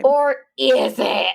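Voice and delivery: slow, spooky or is it; dramatic tease. Pretend. Teasing a twist to the co-host, slow and ominous. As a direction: draw out the teaser like a trailer. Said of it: "dramatic tease".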